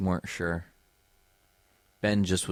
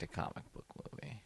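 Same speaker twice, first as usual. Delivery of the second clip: whisper; 4 semitones higher, 14 dB quieter, faster than usual. Real, not acted. Wry aside after agreeing.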